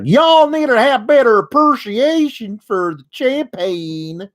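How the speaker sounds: put-on Southern accent. Heyman mocking the crowd in a put-on Southern accent. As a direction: ham up a Southern drawl on the champagne line. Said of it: "a Kentucky accent".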